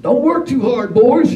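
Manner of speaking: loud, high ribbing call; Billy calling after the workers, loud, high teasing; teasing farmer. Retold or imitated. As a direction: tease the boys loudly and high. Billy voiced in story.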